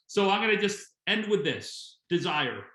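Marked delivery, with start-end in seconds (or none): none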